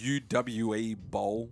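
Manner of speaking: U W E Boll, spelled letter by letter, slowly; spelled-out name. Shown as a demonstration. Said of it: "however you say his name".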